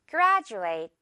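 "graduate" said GRAD-joo-ate, d turned to j; j sound in graduate. Shown as a demonstration. Teacher modelling "graduate" for the class, j sound. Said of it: "듣고 따라해주세요".

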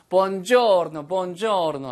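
loud, playful Italian greeting; Italian accent. Acted. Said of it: "이렇게 발음을 하겠죠".

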